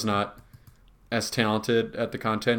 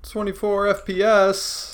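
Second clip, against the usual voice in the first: impressed; 9 semitones higher, slower than usual. Acted. Marvelling at the frame rate.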